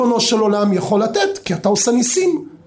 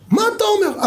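amazed; 11 semitones higher, faster than usual. Acted. Friend amazed at generous father.

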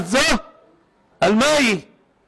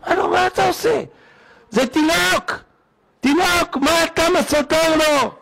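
much higher, outraged father; shocked protest; 11 semitones higher than usual. Retold or imitated. Father objects to the doctor slapping his baby, high outraged protest. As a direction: protest in disbelief, drawing it out.

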